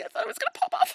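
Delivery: very high, squealing disgust; grossed-out squeal. Real, not acted. Recoiling after freaking gross bird task, squealing. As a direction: let the pitch fly up, squeamish.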